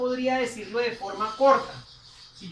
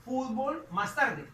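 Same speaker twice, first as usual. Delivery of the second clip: each word drawn out slowly, x0.3 pace; slow teacher dictation; 2 semitones higher, 3 dB louder, much slower than usual. Shown as a demonstration. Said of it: "de forma negativa".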